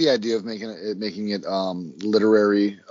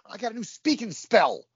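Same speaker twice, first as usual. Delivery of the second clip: high excited mock writer; raised excited mock voice; 8 semitones higher than usual. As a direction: ham up a giddy eighties screenwriter. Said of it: "They're like".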